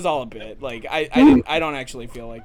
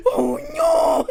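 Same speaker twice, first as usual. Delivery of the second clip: much higher crying-laughing wail; imitated wail; 6 dB louder than usual. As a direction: cry it out in a laughing mock wail. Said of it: "He's like".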